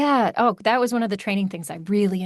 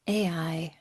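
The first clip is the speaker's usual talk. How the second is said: dreaded whisper; 2 semitones lower, 5 dB quieter than usual. Real, not acted. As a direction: whisper A I under your breath. Dreading the topic of AI.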